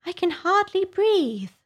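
very high, slow Dormouse; high-pitched plaintive little creature; Dormouse complaining. A narrator's reading. Dormouse complains at being squeezed.

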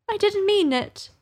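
put-on high, pleading little girl. Alice pleading with the offended mouse, high and imploring. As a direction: beg the mouse to forgive her. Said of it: "pleaded poor Alice".

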